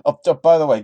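ordinary delivery

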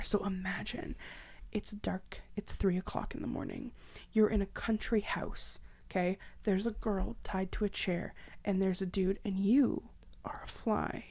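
soft, half-whispered setup; hushed storyteller easing into a spooky tale; hushed scene setting. Acted. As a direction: draw listeners into the dark scene. Sets scene before immersive story.

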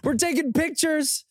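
calling his cohost over for a photo, excited. Retold or imitated. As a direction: shout across to a friend, thrilled. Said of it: "I was like".

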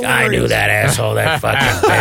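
lightly voiced ranting old actor; Lawrence Tierney rattling off. Actor rattling off insults at the movie, imitated rant. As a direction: imitate a heckler grumbling at the screen. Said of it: "it's Lawrence Tierney just rattling off".

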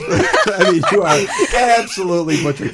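laughing through the words; half-laughing complaint. Real, not acted.

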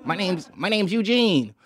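soft, slow nerd voice; mock-meek Eugene impression. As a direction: pull way back into a hushed, awkward introduction.

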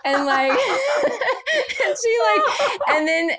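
talking through high helpless laughter; can barely get words out for laughing; real laughter breaking up the sentence. Real, not acted. Mum cracking up telling story.

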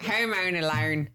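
slow, exaggerated Australian accent. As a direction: draw it out like a comic Australian. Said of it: "Like an Australian".

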